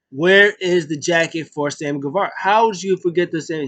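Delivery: retold scolding, rising in pitch; indignant demand. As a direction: demand an answer, hammed up. Acted demand over missing gift.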